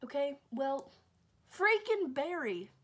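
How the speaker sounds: high, loud, slow exasperated grumble. Real, not acted. Babysitter cursing the silent dog, loud and exasperated. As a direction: drag out her annoyance at the dog.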